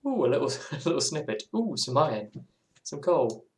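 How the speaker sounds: delighted at every little find; excited cave exploring. Retold or imitated. Player exploring a cave, excitedly cooing over finds. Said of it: "'Cause I'm like".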